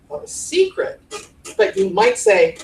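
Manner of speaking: ordinary talk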